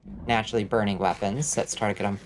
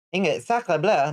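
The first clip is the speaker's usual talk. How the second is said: hammed-up French accent on sacre bleu; French exclamation in an exaggerated accent; mock French accent; 5 semitones higher than usual. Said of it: "My outrageous accent is getting away from me".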